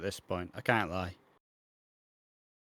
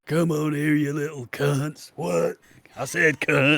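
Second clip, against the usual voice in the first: raspy British Stone Cold, higher and louder; Stone Cold impression; 5 semitones higher, 5 dB louder, slower than usual. Stone Cold bullying the lads into signing, mock raspy. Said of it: "your Stone Cold impression".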